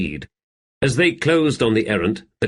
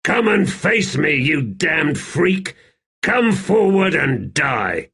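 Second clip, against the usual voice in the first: higher and louder, dragged out slowly; furious taunt; 8 semitones higher, 3 dB louder, slower than usual. A narrator's reading. Swordsman taunting the Emperor to fight, a slow furious shout. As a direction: spit each word of the dare, slow and furious. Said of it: "he shouted".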